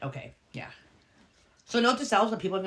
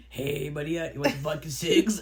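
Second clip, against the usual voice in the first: joking Russian voice, lower; mock Russian accent; 8 semitones lower than usual. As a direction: tempt a kid with vodka in a faint Russian accent. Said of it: "be like".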